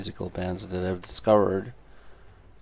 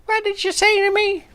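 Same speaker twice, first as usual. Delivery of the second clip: loud, very high offended crone; crazy old lady; 8 dB louder than usual. Acted. Crazy old lady insulted back, high outraged screech. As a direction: shriek it like an outraged old woman. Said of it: "you crazy old bitch".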